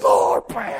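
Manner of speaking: high, loud mock prayer cry; forceful prayer. Preacher mimicking Korean church hands-on prayer, forceful cry. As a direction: belt out an overzealous prayer.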